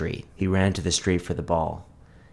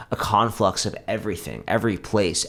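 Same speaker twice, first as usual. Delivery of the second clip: ordinary delivery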